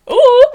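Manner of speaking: loud, high mock squeal; comic awkward "ooh". Avoiding an awkward hand touch, high mock squeal.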